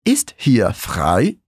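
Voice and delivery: Ist hier frei very slow, ending on a rise; slow model phrase. Shown as a demonstration. Teacher asking Ist hier frei slowly, with a rising end. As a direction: ask it slowly as a drill. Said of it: "Como eu pergunto".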